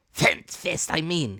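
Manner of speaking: high, loud impatient snap; crying out angrily. Acted. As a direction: correct himself in a rage. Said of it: "he cried".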